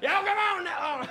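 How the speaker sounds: lightly voiced country attendant; Southern accent. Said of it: "the guy from the gas station".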